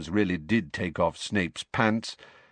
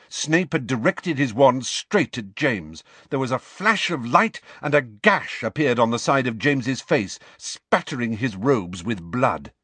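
ordinary delivery, nothing marked

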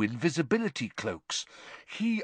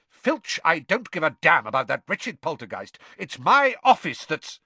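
loud, raised-pitch irritation; exasperated outburst; 5 semitones higher, 6 dB louder than usual. A narrator's reading. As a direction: snap impatiently at an underling. Snape cuts Filch off angrily.

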